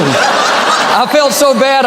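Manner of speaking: slow, laughing mock regret; hammed-up sorrow, laughing. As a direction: drag it out through laughter. Laughing retold feeling.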